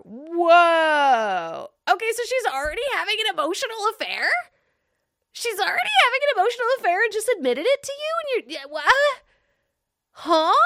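high, fast, incredulous rant; shocked disbelief. Real, not acted. Reacting to chat about the wife's affair, in high fast disbelief. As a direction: rattle it off in disbelief.

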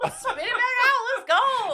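high, loud, laughing exclamation; mock fed-up outburst. Friend urging him to reject the drink, playfully loud. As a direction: call it out loudly, half laughing.

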